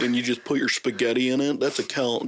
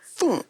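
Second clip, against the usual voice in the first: whoosh noise. Retold or imitated.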